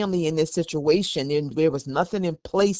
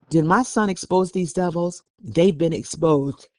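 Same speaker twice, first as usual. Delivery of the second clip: ordinary delivery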